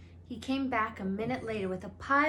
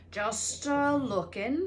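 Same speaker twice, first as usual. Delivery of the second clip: slow, shifty Hagrid mumble; 4 semitones higher, 5 dB louder, slower than usual. A narrator's reading. Hagrid dodging the children's question, shifty and slow. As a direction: hedge it like you're hiding something. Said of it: "he said in a shifty voice".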